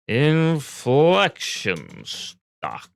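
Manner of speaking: very slow, each word drawn out for the computer; deliberate command to the AI; slow dictation. Real, not acted. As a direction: spell it out for a dumb voice assistant.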